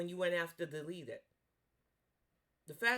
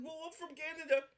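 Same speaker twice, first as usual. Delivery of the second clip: mock innocent Canadian; 7 semitones higher than usual. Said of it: "you wanted to act like".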